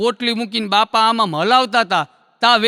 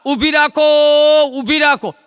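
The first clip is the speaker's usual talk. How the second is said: mock shout; 5 semitones higher, 4 dB louder than usual. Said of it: "માલો રાઈડે ride ને બોકા".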